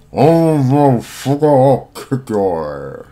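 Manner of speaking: loud, slow, talking around a hand in mouth. Retold or imitated. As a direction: yell it with a hand in your mouth. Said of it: "I can't really talk with my hand in my mouth".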